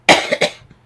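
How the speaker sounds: sudden loud real cough. Real, not acted. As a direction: cough hard mid-sentence. Coughing mid-sentence.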